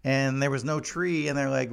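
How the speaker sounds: normal talking voice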